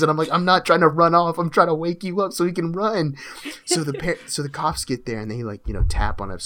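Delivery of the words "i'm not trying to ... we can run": higher and much louder, pressing and urgent; urgent shout. Retold or imitated. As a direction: yell at him to get up and run. Urging passed-out friend to flee.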